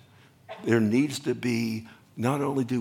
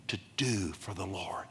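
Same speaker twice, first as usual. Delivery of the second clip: whisper; 5 semitones lower, 10 dB quieter than usual. Real, not acted. Preacher suddenly whispers.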